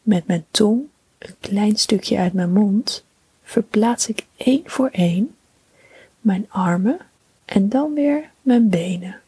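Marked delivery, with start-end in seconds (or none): none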